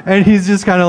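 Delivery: cracking up at his own story; laughing. Real, not acted. Speaker at a tech talk retelling a prank call, laughing.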